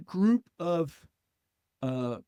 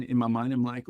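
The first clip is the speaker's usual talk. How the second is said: normal talking voice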